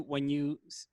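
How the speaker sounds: normal talking voice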